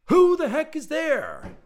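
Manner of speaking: very high, loud, slow holler; grumpy shout. A narrator's reading. Grumpy inventor hollering out the window at an intruder, shouting. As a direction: yell it testily into the night. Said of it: "the equally grumpy Doctor Electro".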